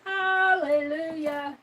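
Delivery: slow, drawn-out relieved hallelujah. Real, not acted. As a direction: sing out relief slowly. Relieved exclamation.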